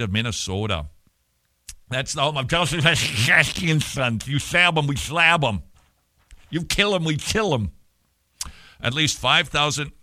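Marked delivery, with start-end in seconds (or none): 2.5-7.7 spitty, slushy lisp on "Klakatsky and Sons"; spray "Klakatsky and Sons" out with a loud lateral lisp. Pretend. Host reprising funeral home gag, "Klakatsky and Sons" in a spitty lateral lisp. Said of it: "There is so much spit".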